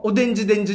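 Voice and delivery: おでんじでんじ with the R sounds turned into D; mom's mispronunciation. Retold or imitated. Son imitating how his mom says Orange Range: D for R. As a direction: say おでんじでんじ, R swapped for D. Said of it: "って言うし".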